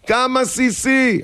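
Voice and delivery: high, drawn-out holler; shouted question. Acted. Husband asking wife the measure, high slow shout. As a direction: stretch it out, calling across the house. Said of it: "עכשיו צעקה שתיים".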